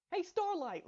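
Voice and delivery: high call across the room. A narrator's reading. Rainbow calling to Starlight from her table. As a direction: call over to a friend. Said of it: "Rainbow called".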